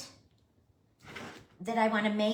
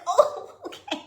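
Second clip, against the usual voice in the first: laughing; 13 semitones higher, 7 dB louder than usual. Retold or imitated. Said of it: "I'm like".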